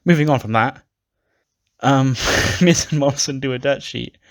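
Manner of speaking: real laugh breaking into the words. Real, not acted. Host moving on to Miz and Morrison's dirt sheet, laughing.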